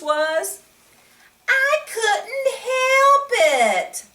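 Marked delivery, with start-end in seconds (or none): 1.5-4.0 put-on high, slow, whining little boy; a boy excusing his silly face; whiny child. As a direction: plead like a kid caught out.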